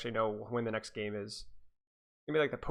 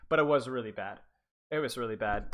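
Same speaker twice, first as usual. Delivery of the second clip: normal talking voice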